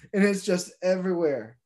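normal talking voice

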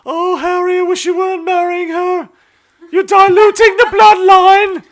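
very high, loud, hammed-up royal impression; shrieking mock-regal falsetto, words drawn out; the Queen. The Queen scolding Harry over his marriage, hammed-up high royal wail. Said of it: "I always just kinda picture".

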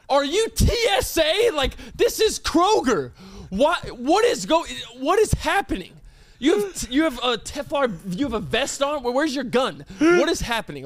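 high-pitched, shouted disbelief; hammed-up outraged bewilderment; incredulous outburst. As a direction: squawk it out, utterly baffled. Outraged mocking of manager.

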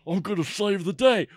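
put-on Southern movie-hero accent. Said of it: "Why are you doing the Southern accent?".

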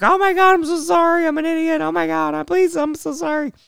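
high-pitched mock panic, apologies tumbling out; freaking out. Mom apologizing over the damaged car, mock freak-out. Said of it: "my mom starts freaking out".

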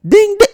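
very high, loud ding-ding mimic; imitating doorbell. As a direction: hit it like a sharp doorbell chime. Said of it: "he rang the doorbell like he lives here".

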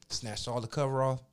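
usual speaking voice

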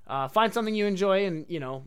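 ordinary talk

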